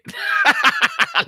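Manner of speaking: sudden high burst of laughter. Real, not acted. Laughing at absurd chat.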